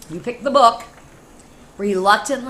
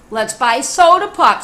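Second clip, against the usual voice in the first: high, much louder eager pig; second pig's voice; 5 semitones higher, 11 dB louder than usual. Acted. Second pig begs for soda pop, high and loud. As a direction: squeal it out like a greedy piglet. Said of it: "said the second pig".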